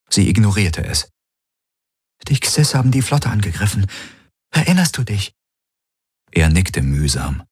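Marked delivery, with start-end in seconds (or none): 2.3-5.3 higher-pitched character voice, quick then questioning; concerned woman companion. Acted. Kirai explains the attack to waking Garland, concerned. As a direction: voice her higher, filling him in.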